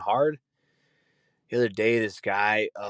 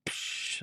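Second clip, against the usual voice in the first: soft hiss; 11 dB quieter than usual. Retold or imitated. Ed Bassmaster shush face.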